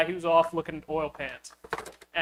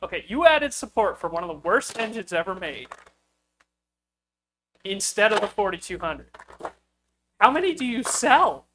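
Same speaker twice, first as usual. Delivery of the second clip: rising exasperation that ends higher and louder on the question; getting angry; 3 semitones higher, 5 dB louder than usual. Retold or imitated. Challenging the vendor about their sales, irritated and climbing. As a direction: press him with incredulous irritation. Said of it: "I got progressively more angry".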